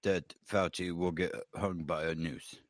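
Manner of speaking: low, halting pundit voice; William F. Buckley. Acted. Comedian poses a mock question to the panel, Buckley impression. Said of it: "why are you doing William F. Buckley's voice".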